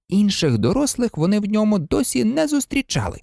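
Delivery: ordinary delivery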